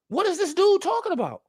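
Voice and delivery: ordinary talk, nothing marked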